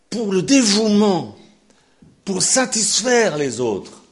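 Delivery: loud, high, slow preaching; fervent preaching. Acted. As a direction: pound it out from the pulpit. Preaching at full force. (prédication fervente et forte)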